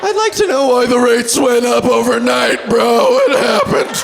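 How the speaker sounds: rising into a jokey yell; mock angry bro. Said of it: "They get mad".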